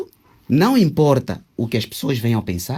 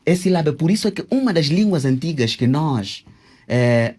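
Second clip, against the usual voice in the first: ordinary delivery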